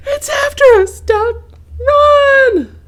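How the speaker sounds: very high, loud panicked shout; terrified cry. A narrator's reading. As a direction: scream to run for your life. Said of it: "cried Goose".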